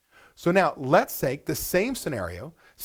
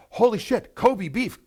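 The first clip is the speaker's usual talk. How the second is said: thrilled owner; 6 semitones higher, slower than usual. Retold or imitated. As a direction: gasp it out in delight.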